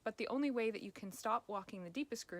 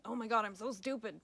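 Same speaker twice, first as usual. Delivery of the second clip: louder, exasperated self-scolding; self-critical outburst; 6 dB louder than usual. Retold or imitated. Coach imitates the inner critic's voice: a louder exasperated groan. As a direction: berate yourself over a mistake. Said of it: "going down the ... pathway".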